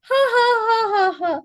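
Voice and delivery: loud, high staged laugh; mock laugh into a phone; mobile phone laughter. Shown as a demonstration. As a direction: burst into deliberate ha's, falling in pitch. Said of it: "it's time to laugh".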